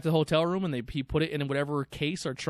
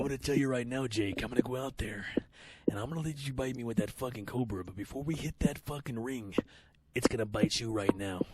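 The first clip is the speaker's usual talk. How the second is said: a hint of Macho Man, softer and growled low; Macho Man impression; 3 semitones lower, 6 dB quieter than usual. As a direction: wrestler taunting Jake before the match, mock gravel. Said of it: "like fucking Macho Man".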